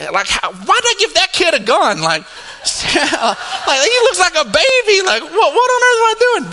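mock-horrified, high-pitched outburst; incredulous self-reproach. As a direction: exclaim it in comic panic at yourself. Mock-panics at own parenting.

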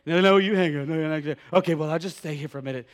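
put-on sugary lovestruck teen voice; giggly late night call. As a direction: coo it like a smitten teenager. Teen lovers on the phone.

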